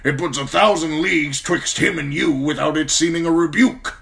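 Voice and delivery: put-on a gruff old commander praising a young man; gruff old lord commander. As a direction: speak as the stern old Lord Commander, grateful but brusque. Mormont voice.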